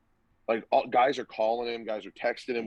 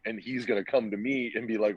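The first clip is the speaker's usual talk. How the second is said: ordinary talk, nothing marked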